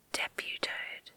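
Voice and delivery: ordinary delivery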